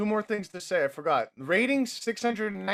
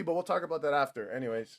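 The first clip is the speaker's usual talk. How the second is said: ordinary talk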